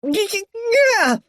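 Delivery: high, stammered yelp; frightened cry. A narrator's reading. Harold backing up George, high frightened stammer. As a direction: stutter it out in mock terror. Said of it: "cried Harold".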